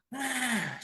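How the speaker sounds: a long, high sigh. Retold or imitated. The pastor sighing before he answers, high sigh. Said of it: "sabi niya".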